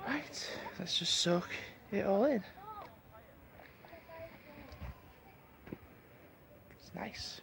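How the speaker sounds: soft whisper so nobody wakes; hushed early-morning whisper. Real, not acted. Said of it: "I'm whispering because it's still early".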